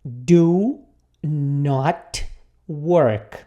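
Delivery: each word drawn out and separated by pauses; slow model sentence. Shown as a demonstration. Teacher modelling the English negative for beginners, slow and separated. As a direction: say it word by word, very slowly. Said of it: "eu preciso dizer".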